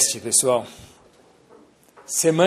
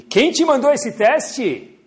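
emphatic question; 10 semitones higher, 7 dB louder, much slower than usual. Real, not acted. Rhetorical question to the audience.

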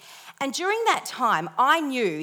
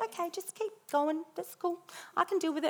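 calm God voice; 8 semitones higher, 8 dB quieter than usual. Retold or imitated. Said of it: "God's very silent and just goes".

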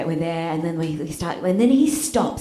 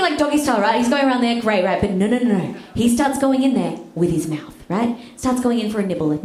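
usual speaking voice

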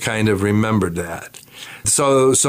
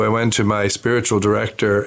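ordinary delivery

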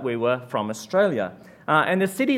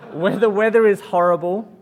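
laughing; 5 semitones higher, 7 dB louder than usual. Real, not acted. Laughing at armpit of France.

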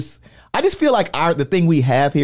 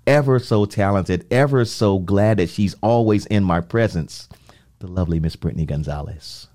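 grand introduction; 8 semitones lower than usual. Acted. Host introducing co-host, lavish announcer build-up. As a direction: hype her up like an announcer.

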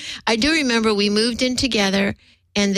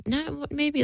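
ordinary talk, nothing marked

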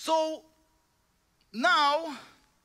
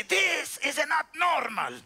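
put-on slower Cuban-accented "This is not normal."; Miguel's Cuban accent; 5 semitones higher, slower than usual. Showing how the Cuban mechanic said it, slow and accented. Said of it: "This is how he would say".

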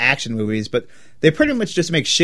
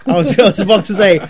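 laughing; 5 semitones higher, 7 dB louder, faster than usual. Real, not acted. Laughing at own mistake.

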